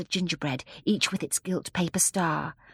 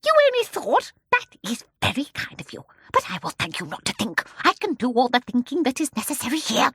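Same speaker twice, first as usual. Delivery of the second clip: high, scornful mock politeness; terrible, menacing; 7 semitones higher, 5 dB louder than usual. A narrator's reading. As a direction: pounce on the excuse with cutting scorn. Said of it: "in her soft, terrible voice".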